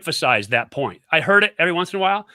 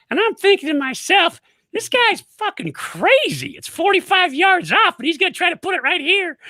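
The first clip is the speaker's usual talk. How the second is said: much higher, squeaky Maryland coach voice; squeaky excited coach. Retold or imitated. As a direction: play the excited head coach in a high squeak. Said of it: "Maryland accent, real, like, high squeaky voice when he gets excited".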